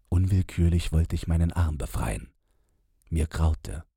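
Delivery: plain reading voice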